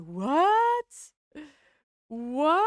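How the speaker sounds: loud, baffled double what; shocked what. Pretend. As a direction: voice a confused shocked emote. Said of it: "en mode".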